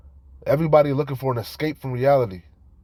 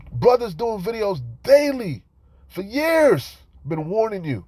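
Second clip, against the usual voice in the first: high, loud, fervent preaching cry; full-force preaching; 8 semitones higher, 4 dB louder, slower than usual. Acted. Preacher scolding viewers who ignore daily videos, loud and fervent. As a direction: cry it out like a street preacher pleading.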